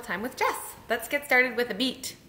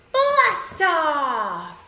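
high, drawn-out "blast off"; excited launch call; 4 semitones higher, 4 dB louder than usual. Shown as a demonstration. Leader launching the children's rocket, excited. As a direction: launch it high, then swoop down.

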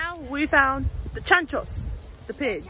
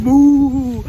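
mock cow moo; 2 semitones lower, 10 dB louder than usual. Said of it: "they were like".

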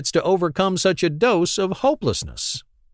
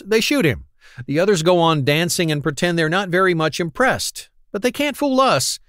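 ordinary talk

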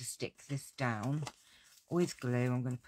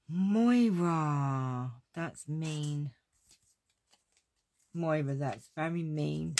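mock outrage; 2 semitones higher, 5 dB louder, much slower than usual. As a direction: pretend to be shocked by a cheeky friend. Mock outrage at a teasing viewer.

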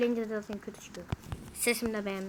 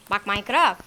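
loud call at the game; 3 semitones lower, 10 dB louder than usual. Real, not acted. Scolding the game loudly.

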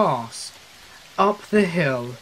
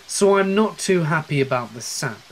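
ordinary delivery, nothing marked

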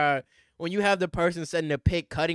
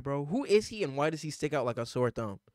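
ordinary talk, nothing marked

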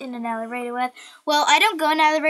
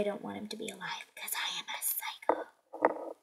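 mock psycho whisper; 4 semitones lower, 13 dB quieter than usual. Girl teasing her friend in a mock psycho whisper. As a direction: breathe it like a playful psycho. Kids joking about wanting him dead.

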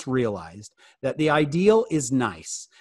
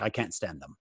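put-on lower, softer British accent; 8 semitones lower, 9 dB quieter than usual. Adults complaining about teenagers in a low British accent. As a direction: drop into a quiet British accent. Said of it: "I kinda did a little British accent there".

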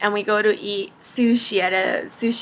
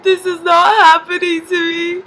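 high, loud mock-tearful whine; hammed-up woeful disbelief; retold despair; 8 semitones higher, 5 dB louder than usual. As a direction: whine it as if about to cry. Daughter retells her despair at dinner.

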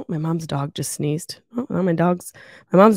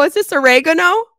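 mock surprised; 9 semitones higher, 7 dB louder than usual. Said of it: "I go".

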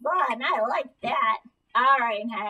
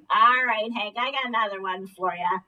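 ordinary delivery

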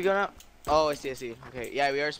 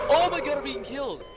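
high, panicked cry; panicked yell; 10 semitones higher, 4 dB louder than usual. Real, not acted. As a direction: yelp in panic. Panic while killed in game.

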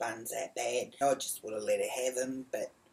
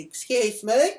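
raised, high call for attention; retold yell, pitched up and loud; 10 semitones higher, 9 dB louder, slower than usual. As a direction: call out to someone walking off. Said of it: "I yelled out".

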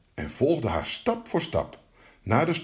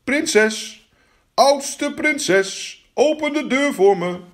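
high, drawn-out call through a door; high-pitched singsong summons; frog calling out; 12 semitones higher, 4 dB louder, much slower than usual. Acted. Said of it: "en riep".

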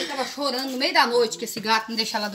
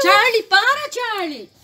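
sharp loud shout, higher; yelling at the cat; 11 semitones higher, 11 dB louder, slower than usual. Real, not acted. Mother shouting at the cat Charlie to stop. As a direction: shout the cat off whatever he's doing.

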